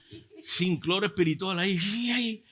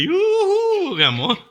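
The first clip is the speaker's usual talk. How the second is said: high, loud "yoo-hoo" call; imitated wife calling her sweetheart; wife's playful call; 10 dB louder, much slower than usual. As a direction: sing out a mock wifely greeting. Acting out wife's flirty call.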